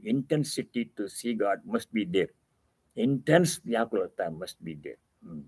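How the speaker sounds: usual speaking voice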